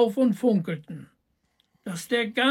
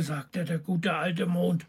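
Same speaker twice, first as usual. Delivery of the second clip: ordinary delivery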